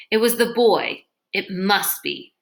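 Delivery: plain reading voice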